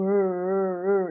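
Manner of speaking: R held and voiced, vibrating in the throat. Shown as a demonstration. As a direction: let the R buzz in the throat. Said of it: "R também vibra".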